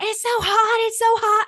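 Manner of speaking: imitazione di una ragazzina che si lamenta, molto più acuta e più forte; mock whiny girl. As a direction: squeal it like a spoiled kid making a fuss. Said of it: "she's like".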